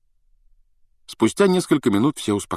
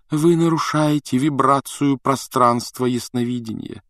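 high, singsong reproach; mystic teacher's reproach; 7 semitones higher than usual. A narrator's reading. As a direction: chide them in a seer's lofty lilt. Трелони упрекает учеников, её голос. (напевно, с укором)

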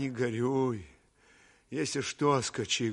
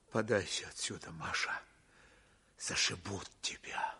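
faint whisper; 3 semitones lower, 5 dB quieter, faster than usual. Acted. Dying commander weakening.

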